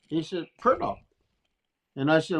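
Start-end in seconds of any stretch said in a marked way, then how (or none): 0.6-1.0 higher-pitched imitated Navajo accent; "Purdog" as two short syllables, mock Navajo accent; heavy Navajo accent. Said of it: "he's had his heavy Navajo accent".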